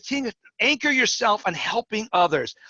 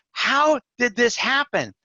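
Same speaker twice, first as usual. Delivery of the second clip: slow wonder; 6 semitones higher, slower than usual. Retold or imitated. Boy sees the backyard trees.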